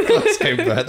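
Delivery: high, loud, laughing mock Glaswegian; stretched-out, badly faked Glasgow accent. Echoing the co-host's Scottish, laughing mock Glasgow accent. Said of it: "I was trying to say that".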